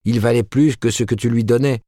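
wife retorting to husband, defiant. A narrator's reading.

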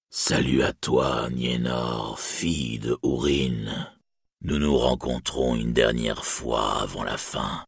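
low, slow dying dragon voice, whispered in places; panting dragon. A narrator's reading. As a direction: breathe out each word like a dying dragon. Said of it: "Et haletant, il parla". (voix grave et lente d'un dragon mourant, haletant)

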